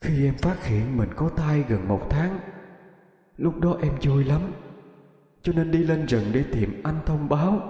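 gently sorrowful remembering; wistful recollection. A narrator's reading. Ghost telling her lover of the pregnancy, wistfully sad.

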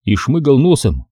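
plain reading voice

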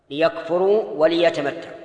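both "ليكفروا" and "وليتمتعوا" with li-; lam with kasra. Shown as a demonstration. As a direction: sound an i after each l in "ليكفروا وليتمتعوا". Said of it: "تكون مكسورة بكل حال".

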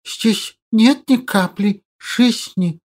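soft lisping hiss, drawn out; hissing creature voice. Acted. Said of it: "отозвался Злыдень".

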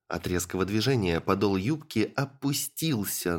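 usual speaking voice

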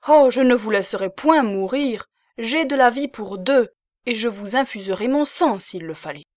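loud, high, fierce outburst of devotion; violent exclamation. A narrator's reading. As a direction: fling it out, naive and forceful. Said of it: "cette exclamation violente et naïve".

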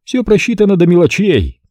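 a confident host relishing his plan; Stanton's voice. A narrator's reading.

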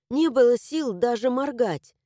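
usual speaking voice

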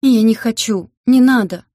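high, loud begging scream; a captive shrieking in panic to be spared; terrified pleading. A narrator's reading. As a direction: beg for your life at full voice. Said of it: "умолял Гарьян".

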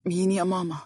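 drowsy, half-awake mumble; sleepy. A narrator's reading. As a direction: son just woken, asking his mother. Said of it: "يحاول فتح عينيه".

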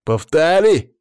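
high, loud, slow furious shout; loud demanding shout. A narrator's reading. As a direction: yell a challenge across the room. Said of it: "крикнул ей некромант".

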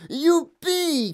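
high, loud whoop; jubilant shout. A narrator's reading. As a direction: cheer it out with a long high ending. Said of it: "cria-t-il".

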